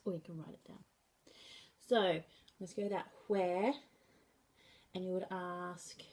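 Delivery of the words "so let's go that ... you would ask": ordinary delivery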